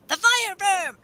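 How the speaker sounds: name the beast up high and dramatic; hammed-up spooky title voice; high dramatic monster name. Shown as a demonstration. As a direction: announce the fire verm like a movie trailer. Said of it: "repeats in a voice".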